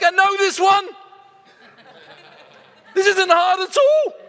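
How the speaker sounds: high, loud and gleeful with a laugh; proud know-it-all client, laughing loudly; eager client. Retold or imitated. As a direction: play the delighted client who knows the answer. Clients eagerly answering question.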